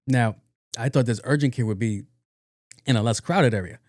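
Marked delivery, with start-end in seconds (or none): none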